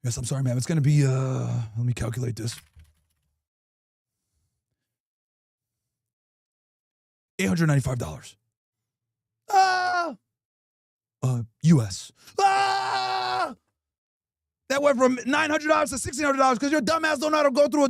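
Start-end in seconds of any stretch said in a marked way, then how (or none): 0.0-8.4 deep, stiff voice of a school principal. Retold or imitated. Principal telling the mom her son broke the doors, deep and formal. 9.5-10.2 mock shocked gasp. Mom's shocked reaction to bill. 11.2-12.1 deep, stiff voice of a school principal. Retold or imitated. Principal telling the mom her son broke the doors, deep and formal. 12.4-13.5 mock shocked yell. Mom's shocked reaction.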